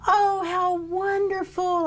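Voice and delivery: very high, slow, gushing mother's voice; mother delighted by the news of twins. Retold or imitated. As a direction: coo it like a thrilled grandmother. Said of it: "my mother went".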